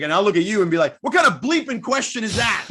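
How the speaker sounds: laughing through a mock gruff retort; hammed-up coach retort with a laugh; mock Pop retort. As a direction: play the coach's jab, half laughing. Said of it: "he's like".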